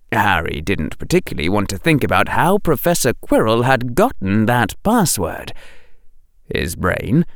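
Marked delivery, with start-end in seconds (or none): none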